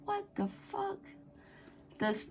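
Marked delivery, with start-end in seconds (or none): 0.1-1.0 high, startled what the fuck; shocked exclamation. Retold or imitated. Telling her partner about the spider, startled high exclamation. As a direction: gasp it out in shock. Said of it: "and I said".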